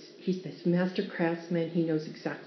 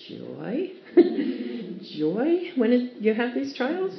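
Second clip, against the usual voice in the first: incredulous retold thought; 5 semitones higher, slower than usual. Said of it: "I thought".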